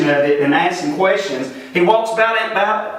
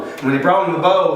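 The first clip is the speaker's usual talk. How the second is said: ordinary talk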